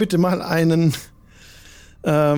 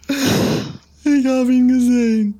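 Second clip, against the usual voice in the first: high, slow, blissful weeping; crying with joy; 7 semitones higher, much slower than usual. Acted. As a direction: sob it out in rapture. Roland weint vor Glück. (vor Glück weinend)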